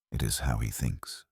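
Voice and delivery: ordinary delivery, nothing marked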